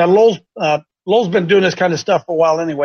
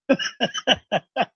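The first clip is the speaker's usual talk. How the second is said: soft, breathy chuckle; a quiet laugh at the coincidence; 5 semitones higher, 7 dB quieter than usual. Real, not acted. Host laughing softly at the odd coincidence.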